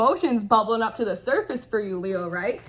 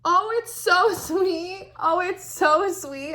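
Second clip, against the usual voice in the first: touched, near tears; 7 semitones higher, much slower than usual. Real, not acted. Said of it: "I literally might, I might cry".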